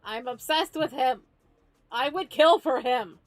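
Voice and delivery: loud, building fangirl declaration; yelling obsession. Real, not acted. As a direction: shout your devotion to the world. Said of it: "I was just yelling to the world".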